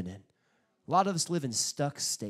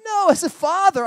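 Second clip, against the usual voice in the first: much higher, much louder preacher cry; emphatic shout; 16 dB louder than usual. Real, not acted. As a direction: hit it hard and high.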